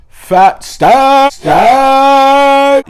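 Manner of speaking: hyped-up flex with a yell; money brag. Acted. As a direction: holler it like a rapper flexing cash. Bragging about a fat wallet.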